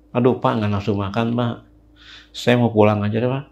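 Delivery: soft, low-energy plea; meek refusal. Retold or imitated. Young man asking the neighbourhood head to let him go, meekly. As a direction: decline quietly and deferentially.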